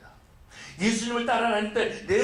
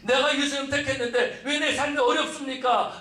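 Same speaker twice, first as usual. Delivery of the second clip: imitated grumbling, higher pitch; complaining to God; 6 semitones higher than usual. Follower asking God why life is hard, complaining. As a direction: protest in a raised, preacherly mock complaint. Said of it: "라고 불평하고 있다라는".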